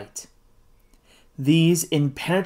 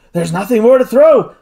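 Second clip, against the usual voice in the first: loud, higher despairing reply; desperate protest, voice raised; 5 semitones higher, 6 dB louder than usual. A narrator's reading. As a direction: answer loudly in despair. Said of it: "Kennedy's despairing response".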